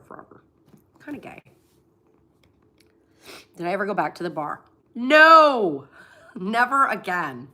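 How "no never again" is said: loud no, laughing. Real, not acted. Vlogger asked about returning to bar, laughing shout.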